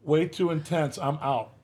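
normal talking voice

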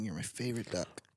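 low, hushed quacky duck voice. Pretend. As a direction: talk softly like a duck. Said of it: "kind of a good impression of a duck".

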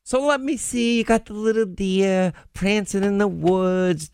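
hammed-up New York film impression; Marisa Tomei movie impression. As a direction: play a New Yorker picturing a little deer. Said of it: "you sound like her".

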